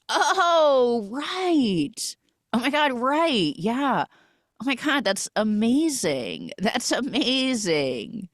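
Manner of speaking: high, drawn-out realization; exaggerated dawning recognition. Man taken aback suddenly recalls her, exaggerated dawning recognition. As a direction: play up suddenly remembering, stretching the words. He finally pretends to remember her.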